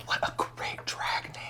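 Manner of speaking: excited whisper; whispered delight. Real, not acted. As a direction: breathe it in awe. Excited hushed remark.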